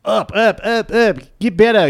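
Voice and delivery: imitated trainer's shout, high and loud, South African twang; Steve Hess. Imitating the trainer shouting at his players, high South African-accented yell. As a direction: bark slogans like a South African coach. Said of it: "just shouting at these guys".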